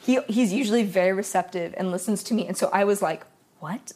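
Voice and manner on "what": small, quiet, low "what"; crushed. Retold or imitated. Girlfriend recalling being cut off, crushed and hushed. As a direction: say it softly, as if the wind's gone out of you. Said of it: "I was crushed".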